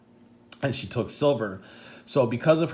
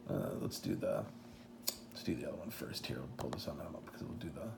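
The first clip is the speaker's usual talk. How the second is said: very soft, low mumble under the breath; half-whispered aside while busy with something; talking to self; 7 semitones lower, 16 dB quieter than usual. Real, not acted. Speaker to himself, finding the next picture, hushed muttering.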